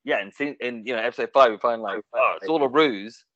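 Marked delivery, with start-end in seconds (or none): none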